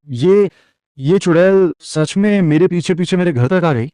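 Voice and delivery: high, frightened cry of disbelief; panicked high-pitched exclamation. Acted. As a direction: voice jumps up in fear. Terrified realisation of real witch.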